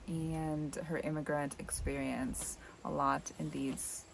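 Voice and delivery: usual speaking voice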